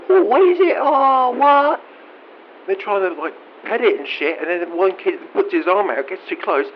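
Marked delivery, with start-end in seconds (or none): 0.1-1.8 put-on high, loud shrieking kid voice; screaming kids. Kids at the zoo shrieking at the goblin, high and loud. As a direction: shriek like an excited child at the zoo. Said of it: "They're either screaming or they're going".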